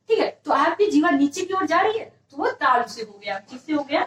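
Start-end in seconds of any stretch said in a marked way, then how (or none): none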